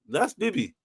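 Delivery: normal talking voice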